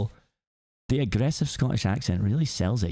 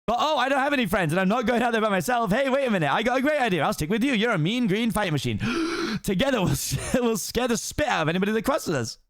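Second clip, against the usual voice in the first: high eager donkey voice; excited Donkey; 11 semitones higher than usual. Acted. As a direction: beg to tag along brightly. Donkey invites himself along.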